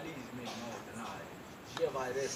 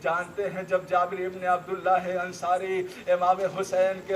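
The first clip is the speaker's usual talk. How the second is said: loud, higher grieving recital; majlis lament; 5 semitones higher, 12 dB louder than usual. Acted. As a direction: let the voice rise into mourning, pleading to the listeners.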